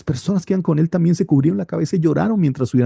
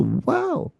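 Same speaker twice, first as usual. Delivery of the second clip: amazed wow; 14 semitones higher, 6 dB quieter than usual. Real, not acted. Reacting to David's wish to flee.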